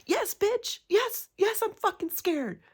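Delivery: high, loud scared shriek in reply; terrified yell. Real, not acted. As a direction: shout back in real fright. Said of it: "I'm terrified".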